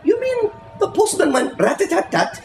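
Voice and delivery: put-on a child checking he understood; small boy asking. Child questions the storyteller.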